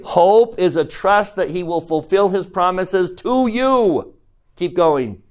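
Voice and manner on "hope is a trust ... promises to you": emphatic teacher's line, landing hard on "you"; stress on YOU. Shown as a demonstration. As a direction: punch "you" high at the end. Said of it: "Emphasis on what word?".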